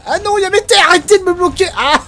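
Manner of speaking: high, loud frantic yelling. Real, not acted. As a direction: yell in gaming panic. Frantic gaming yell.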